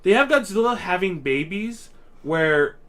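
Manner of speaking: normal talking voice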